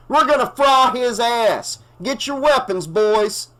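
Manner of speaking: high, loud call to arms; rallying shout. A narrator's reading. The bartender rallies the townies to grab weapons, shouting. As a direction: whip the boys into a mob.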